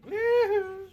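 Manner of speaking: high, loud, joyful woohoo; excited whoop. Real, not acted. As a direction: let out a big delighted cheer. Celebrating in the game.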